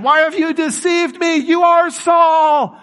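very high, drawn-out dramatized shout; mock outcry. Preacher voices medium recognizing Saul, high mock-horrified cry. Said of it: "cried out at the top of her voice".

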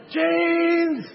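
name yelled high and long; real loud scream. Retold or imitated. Excited dad cheering son's basket, screamed real loud. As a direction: holler the name at full volume. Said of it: "He's real loud".

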